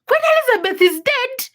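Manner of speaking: high, loud startled question; retelling a stunned reaction; shocked disbelief. Retold or imitated. Shocked reply to friend's news.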